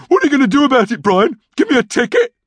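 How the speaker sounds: high, sarcastic challenge; irritated retort. A narrator's reading. Norm daring his brother through the door, irritated.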